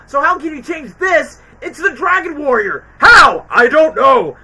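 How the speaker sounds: mock exasperated yell; imitated shout. Acted movie quote, shouting.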